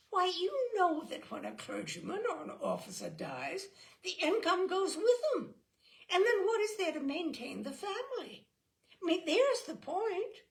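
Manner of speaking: put-on a knowing child, pressing the argument. A narrator's reading. Kitty gives her reason.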